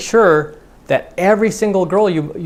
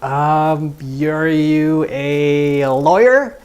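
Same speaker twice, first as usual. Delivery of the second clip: put-on hesitant girl guess; much slower than usual. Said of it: "Be like".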